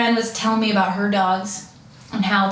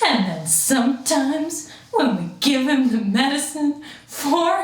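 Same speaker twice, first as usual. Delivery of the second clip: slow, droopy delivery for an anxious dog; slow put-on voice of an anxious Pit Bull mix; slow drawling dog voice; slower than usual. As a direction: drag each line out like a worried dog. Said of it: "he sounds like this".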